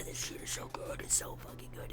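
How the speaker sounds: hushed, breathless whisper of delight; reliving watching the scene, whispered; excited whisper. Retold or imitated. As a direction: whisper it like a secret you can't contain. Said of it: "And I was like".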